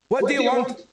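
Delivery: a faint Scottish challenge called across the road; distant Scottish shout. A narrator's reading. Scottish guard calls out to the stranger, a faint Scottish shout. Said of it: "the faint shout in an unmistakably Scottish accent".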